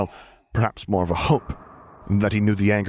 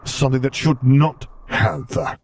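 slow, loud, higher, menacing; dark slow threat; 5 semitones higher, 4 dB louder, slower than usual. A narrator's reading. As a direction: vow it slowly, with menace. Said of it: "growled out darkly".

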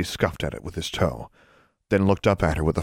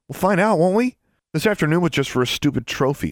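high-pitched defiant retort; 11 semitones higher than usual. A narrator's reading. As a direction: throw the challenge back, pitched up. Loser defiantly challenges gloating rival.

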